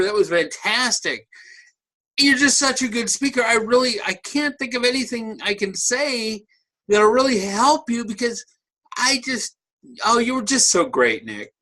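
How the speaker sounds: high-pitched, hammed-up admiration; gushing evaluator. Whitewashing evaluator fawning over the speaker, gushing. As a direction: gush helplessly, as if nothing could be improved. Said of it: "so the evaluator's always saying".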